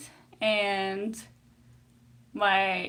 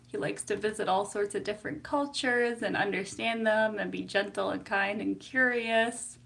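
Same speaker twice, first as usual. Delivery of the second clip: ordinary talk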